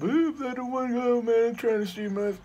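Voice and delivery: high, loud whiny mockery of a friend; teasing a friend by whining in his voice; mocking C pap. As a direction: whine it up high, making fun of him. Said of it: "Be like fucking C pap".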